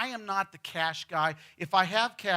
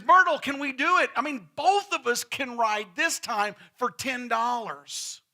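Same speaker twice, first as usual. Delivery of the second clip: put-on louder, eager old man begging; eager husband; 5 semitones higher, 6 dB louder than usual. Old husband begging his wife to ride, louder and eager. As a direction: plead like a kid at the fair. Fred pleading with Myrtle.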